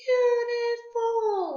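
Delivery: "Beautiful" very high and stretched; drawn-out model word. Shown as a demonstration. Teacher has everyone say the word, high and drawn out.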